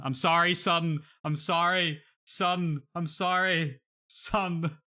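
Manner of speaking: high, loud hammed-up sorry; mock anguished apology. Hammed-up apology to his benched player Son. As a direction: wail it like a tragic farewell.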